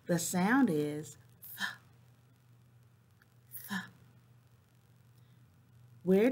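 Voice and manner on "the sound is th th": "th" breathed out long and alone; demonstrating the th sound; target sound shown. Shown as a demonstration. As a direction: hold the "th" sound, tongue between the teeth. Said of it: "tell me where you hear a sound".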